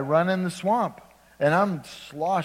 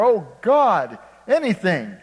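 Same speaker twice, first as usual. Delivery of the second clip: loud, higher, pleading cry to God; desperate plea; 5 semitones higher, 9 dB louder, slower than usual. Pretend. Desperate prayer in trouble.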